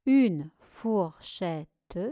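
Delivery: "fourchette" very slow, with the final e sounded; syllable by syllable. Shown as a demonstration. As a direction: stretch out "fourchette", each syllable apart. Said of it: "une seule syllabe". (« fourchette » très lentement, syllabe par syllabe)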